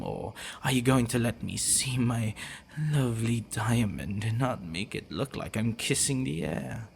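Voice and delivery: ordinary talk, nothing marked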